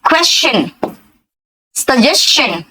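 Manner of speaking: क्वेश्चन and सजेशन said with a ch sound, kwes-chan, sa-jes-chan; teacher models क्वेश्चन and सजेशन, the st said as ch; pronunciation demo. Shown as a demonstration. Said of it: "यहाँ पे ध्यान से देखो".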